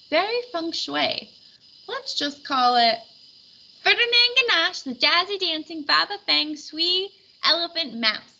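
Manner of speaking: high, excited announcing; triumphant shout. A narrator's reading. Stella May naming the sculpture to neighbors, triumphant. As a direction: proclaim the name like a winner. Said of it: "shouted Stella May triumphantly".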